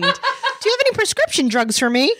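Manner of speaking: high, laughing naive request; naive Andrea. Retold or imitated. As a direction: ask sweetly and naively, pitched high. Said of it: "Hello, I'm Andrea".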